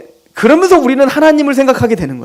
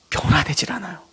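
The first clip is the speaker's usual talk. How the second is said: whisper; 5 semitones lower, 11 dB quieter than usual. Real, not acted. Whispered punchline after heavy preaching.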